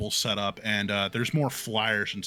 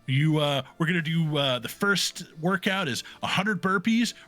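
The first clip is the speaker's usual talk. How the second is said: higher, pumped and gushing about workouts; hyped gym girl; 6 semitones higher, 3 dB louder than usual. Acted. Dwarf girl hyping Rampart about the first workout, pumped. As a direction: fire him up for brutal training. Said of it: "She says".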